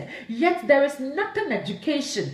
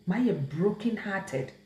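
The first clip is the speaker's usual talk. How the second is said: softer, lower, slow repeat of heartbroken; quiet heartbroken; 6 semitones lower, 6 dB quieter, slower than usual. Real, not acted. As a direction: let it sink, quiet and slow.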